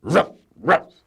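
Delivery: high loud mock bark; dog barking. A narrator's reading. Reader barks for Muffin McClay, a high loud ruff. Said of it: "said Muffin McClay".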